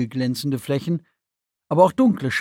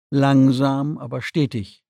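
ordinary delivery

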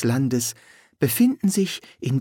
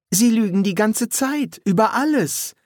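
high, indignant protest; heated accusation; indignant outburst; 9 semitones higher than usual. A narrator's reading. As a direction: burst out with it, pitch well up. Polly bursts out about the lies.

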